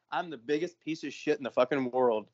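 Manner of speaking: softly retold, dropped in volume; ashamed self-reproach. Shopper blaming himself after her kindness, quietly ashamed. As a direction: say it low to yourself, as if head down.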